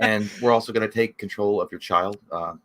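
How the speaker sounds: low, soothing sales patter; smooth vet staffer. Acted. Vet staff easing owners into the bill, smooth and low. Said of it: "like, smooth".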